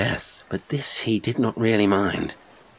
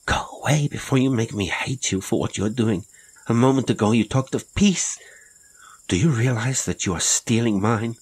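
rising plea; 5 semitones higher than usual. Acted. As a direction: push him away with growing urgency. Inez pleads, rising.

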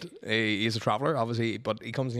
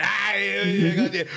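mock outburst; 6 semitones higher, 6 dB louder, slower than usual. Acting out traveller caught lying.

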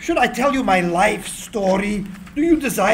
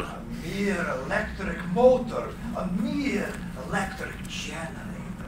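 soft reverie; 2 semitones lower, 10 dB quieter than usual. Acted. Continuing inner reverie.

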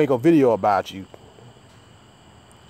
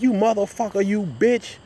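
mock angry cussing; 8 semitones higher than usual. Retelling Monty's angry outburst.

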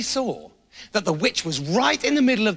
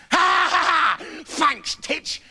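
witch cackle; 8 semitones higher, slower than usual. A narrator's reading. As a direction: cackle triumphantly. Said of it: "said the witch".

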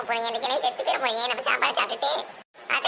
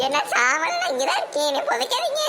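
raised pitch, urgent protest; panicked pleading; 5 semitones higher than usual. Acted. Old woman begging not to be buried, panicked pleading. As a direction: plead higher and higher in alarm. Supposed corpse pleads against burial.

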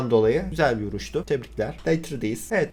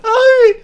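very high, loud cackling laugh then a squeal; shrieking cackle; 12 dB louder than usual. Acted. Streamer voicing the game character's entrance, high shrieking cackle. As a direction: cackle like a wild old hag.

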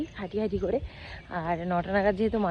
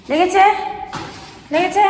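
worried high question; 10 semitones higher, 9 dB louder than usual. Real, not acted. Asking child if hurt.

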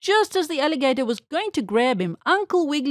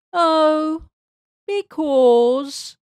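evasive answer; 5 semitones higher, 6 dB louder, much slower than usual. A narrator's reading. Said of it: "answered the bunny".

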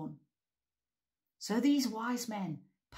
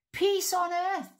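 high loud proclamation; joyful shout; 12 semitones higher, 7 dB louder than usual. Acted. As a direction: angels shout it to the sky. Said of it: "they shouted".

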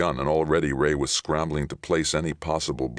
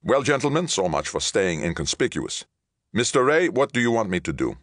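high, louder, pressed address; tense captain; 8 semitones higher, 3 dB louder than usual. Acted. Captain asks Ray for orders, tense. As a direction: demand a decision in a raised voice.

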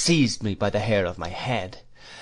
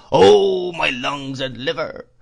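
loud, high "Oh" sinking into a slow whine; gust-like singsong that swells and falls; wailing cry; 4 semitones higher, 6 dB louder, slower than usual. A narrator's reading. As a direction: wail it like a crazed old man. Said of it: "cried the old man".

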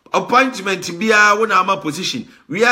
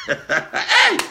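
laugh. Real, not acted. Host bursts out laughing.

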